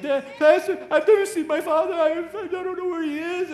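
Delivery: put-on high, loud pleading girl's voice; upset teen girl. Sixteen-year-old girl tells her pastor she never met her father. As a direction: cry it out like a lost teenager. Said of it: "And she's like".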